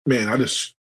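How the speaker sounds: talking around a mouthful of ice; words muffled by ice. Real, not acted. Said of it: "put ice in my mouth at the wrong time".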